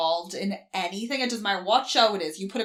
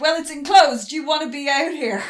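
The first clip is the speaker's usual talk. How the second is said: loud, raised, acted exasperation; exasperated retort; 8 semitones higher, 9 dB louder than usual. Jordan answers reluctant board members, loud exasperated retort. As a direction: argue back urgently over the din. Jordan arguing back, urgent.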